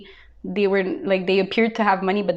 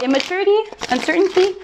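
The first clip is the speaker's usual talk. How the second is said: higher, louder, listing each flaw with scorn; pitched-up scornful list of an ex's failings; mock listing of faults; 8 semitones higher, 3 dB louder than usual. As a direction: rattle off the ex's faults in a lifted voice. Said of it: "You're like".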